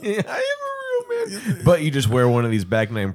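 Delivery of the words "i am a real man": mock high voice. Mocking friend's boast.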